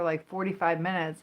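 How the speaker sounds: usual speaking voice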